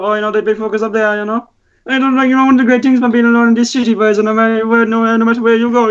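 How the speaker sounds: high sing-song Cork accent. Shown as a demonstration. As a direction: rattle it off in a thick Cork accent. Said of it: "I'm gonna attempt to do it now".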